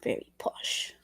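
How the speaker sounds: whispered aside. Real, not acted. Streamer whispering about Scottish word close. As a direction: breathe it like a secret.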